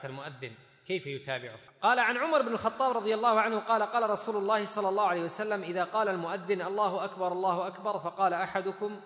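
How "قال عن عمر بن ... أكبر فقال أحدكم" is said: loud, high formal reading; reading out text. A narrator's reading. Teacher reads Umar's hadith to students, raised formal voice. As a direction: read the narration out in a projected voice.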